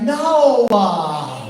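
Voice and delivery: whiny preteen refusal. Retold or imitated. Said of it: "adding an A to the end".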